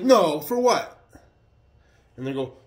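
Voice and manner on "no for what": high, loud retold objection; retold outburst, pitched well up; incredulous protest. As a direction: push back loudly in disbelief.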